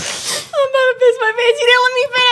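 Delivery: high, laughing through the words. Real, not acted. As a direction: squeal it while cracking up. Can't stop laughing.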